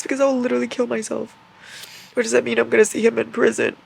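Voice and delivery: high whiny distress; distraught fan whining. Real, not acted. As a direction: wail it high and upset.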